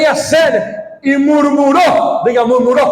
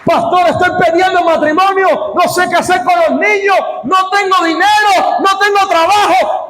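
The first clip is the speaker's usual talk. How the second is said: higher, pleading cries; desperate believer; 7 semitones higher than usual. Retold or imitated. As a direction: sound like a member at wit's end. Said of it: "me manda mensaje, "Pastor".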